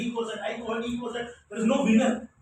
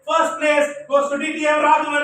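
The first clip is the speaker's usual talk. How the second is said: loud, raised announcer call; 6 semitones higher, 9 dB louder than usual. Retold or imitated. Imagined contest announcer calling out his name loudly. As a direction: announce the winner to the hall. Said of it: "they will say".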